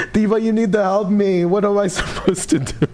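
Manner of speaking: high-pitched, exasperated wail with laughter; desperate laughing plea. Real, not acted. Streamer begging a chatter for dating help, high laughing plea.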